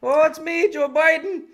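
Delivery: hammed-up greeting to his Irish relatives; Biden impression. Impression of Biden visiting Ireland.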